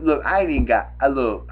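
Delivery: usual speaking voice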